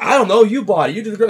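high, loud defensive snap; mock argument. A partner blaming the other, mock high loud snap. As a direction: fire it back at your partner, high and loud.

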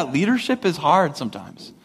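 ordinary talk, nothing marked

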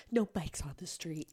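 very soft mock reproach; passive Karen. Woman walking by scolds cyclist, passive. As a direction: imitate a scold said under the breath. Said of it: "a bit more passive too".

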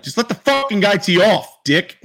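mock British caddy snapping at a fan; British accent. Caddy telling off a heckler in a British accent. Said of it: "in his British accent".

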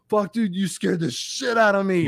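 high, slow imitation of a scared guy; mock shaken relief. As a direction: play someone just frightened out of his wits. Frightened temp reacts after the confrontation.

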